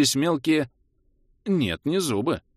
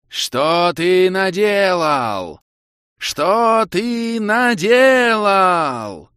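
slow, much higher, much louder monster roar; furious roar; 9 semitones higher, 8 dB louder, much slower than usual. A narrator's reading. Giant cobra roaring at the hero in rage. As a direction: roar each word slowly like an enraged fire serpent. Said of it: "проревела кобра в бешенстве".

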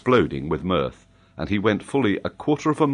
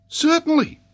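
very high-pitched little pupil; high, confident schoolboy; assured child; 4 dB louder than usual. Acted. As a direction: answer surely in a child's voice. Child answers with full confidence.

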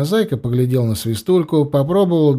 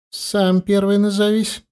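put-on guarded, higher-pitched child's reply; cautious; 7 semitones higher than usual. A narrator's reading. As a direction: answer warily out of the dark. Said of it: "осторожно ответили из темноты". (осторожно, настороженно)

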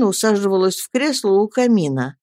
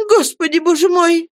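high, louder tearful cry; 11 semitones higher, 5 dB louder than usual. A narrator's reading. Mother cries out at Peggotty, high and loud in tears. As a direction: wail it through tears. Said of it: "плача закричала матушка".

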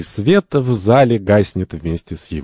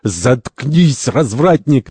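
raised-pitch angry outburst; furious; 6 semitones higher, 3 dB louder, much slower than usual. Acted. Santori leaps from bed at the third, furious. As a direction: cut him off in a rage. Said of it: "Сантори в бешенстве вскакивает с постели".

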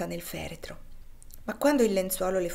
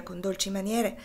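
ordinary delivery

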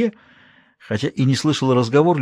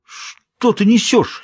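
shocked outburst; 10 semitones higher, much slower than usual. A narrator's reading. Prince shocked by accusation.

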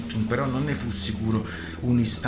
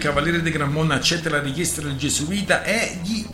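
louder, higher addressing a crowd; loud proclamation; 6 semitones higher, 6 dB louder than usual. Acted. Lorenziglio to the pirates, loud proclamation. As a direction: announce the ruling to the rabble.

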